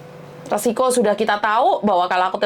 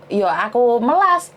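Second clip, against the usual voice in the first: usual speaking voice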